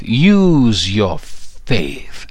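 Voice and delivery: "Use your faith" stretched out very slowly; slow, held-out final plea; drawn-out exhortation. Shown as a demonstration. Preacher closing his charge, very slow. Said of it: "final drawn-out exhortation".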